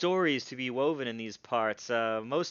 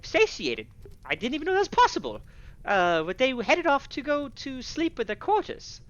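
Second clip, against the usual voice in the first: high-pitched tavern keeper voice; flustered tavern keeper; 12 semitones higher, 6 dB louder than usual. Acted. Game master voices tavern keeper.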